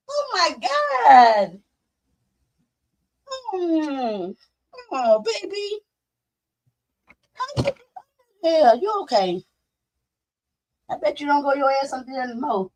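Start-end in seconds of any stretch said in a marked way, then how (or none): 4.9-9.4 tender. Real, not acted. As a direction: soothe a frightened puppy. Comforting rescued puppy.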